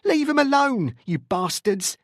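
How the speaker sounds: high, loud angry yell; demanding. A narrator's reading. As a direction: yell at the officers holding your son. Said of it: "she continued to demand".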